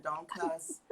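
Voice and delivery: normal talking voice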